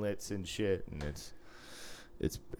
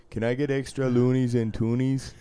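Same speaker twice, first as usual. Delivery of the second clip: put-on higher, louder caricature Canadian accent; mock Canadian accent; 6 semitones higher, 5 dB louder than usual. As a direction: play an oblivious Canadian, accent hammed up. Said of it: "How'd you know I was Canadian".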